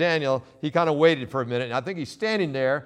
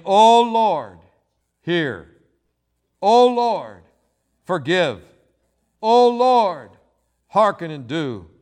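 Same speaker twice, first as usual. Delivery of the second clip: passionate prayer; 3 dB louder, slower than usual. Acted. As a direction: plead with God in fervent bursts. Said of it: "There is passion in this supplication".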